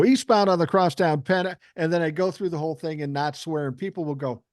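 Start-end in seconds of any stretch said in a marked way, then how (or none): 0.0-1.5 a touch of a radio traffic read; host switching into on-air traffic voice; traffic report. Shown as a demonstration. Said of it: "And I go".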